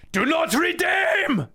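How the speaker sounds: shouted, drawn-out Indian accent, very high. Shown as a demonstration. Streamer modelling the line for the assistant, shouted Indian accent. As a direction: scream it in an exaggerated Indian accent. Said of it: "repeat what I'm about to say in an Indian accent".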